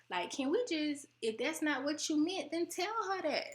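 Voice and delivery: high, pleading, exasperated tone; exasperated plea. Retold or imitated. Telling family to just say what they meant, exasperated. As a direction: throw up your hands and beg her to speak plainly. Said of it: "and I'd be like".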